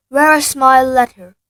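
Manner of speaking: loud, slow demand. Acted. Harry loudly demanding his letter from his uncle. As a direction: insist on your letter. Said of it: "said Harry".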